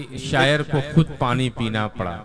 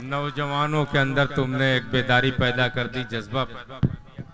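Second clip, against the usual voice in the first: ordinary delivery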